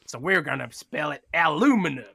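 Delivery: hammed-up American accent; mock American. British co-host imagines an American renaming the metal, mock American accent. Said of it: "some American hundreds of years ago was like".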